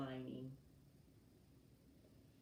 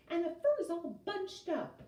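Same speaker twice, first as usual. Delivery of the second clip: higher, indignant huff; huffing Mama Bear; 12 semitones higher, 4 dB louder than usual. A narrator's reading. Mama Bear checking her fancy boots, indignant huff. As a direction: huff like an offended mother bear. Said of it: "she huffed".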